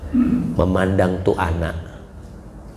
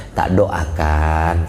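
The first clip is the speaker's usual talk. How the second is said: mother's blessing; 6 semitones lower, much slower than usual. Acted. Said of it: "kata mamanya".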